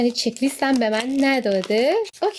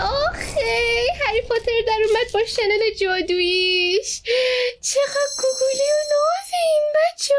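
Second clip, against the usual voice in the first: 1 cute squeal; 11 semitones higher than usual. Real, not acted. Cooing over a cute figure.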